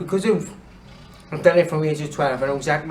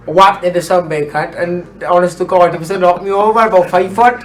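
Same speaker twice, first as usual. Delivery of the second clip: loud, laughing storytelling; excited; 4 semitones higher, 9 dB louder than usual. Real, not acted. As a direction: tell it loud and giddy. Excited retelling of rugby tackle.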